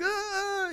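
long high-pitched wail, kept soft; mock screaming cry. Boy crying at his mom, high mock wail. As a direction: imitate a child's hysterical bawl. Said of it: "screaming, crying".

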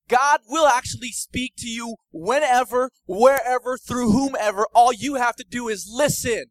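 high, loud pulpit cry; fiery preaching. Acted. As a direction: preach it out to the whole room. Preaching at full force.

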